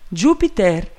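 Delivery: mild acted shout; shouted call. A narrator's reading. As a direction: call out to someone up a tree. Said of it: "gridò il mio amico".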